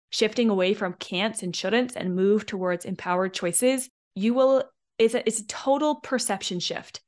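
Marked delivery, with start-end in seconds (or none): none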